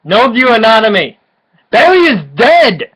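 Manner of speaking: excited. Real, not acted. Excited exclamations.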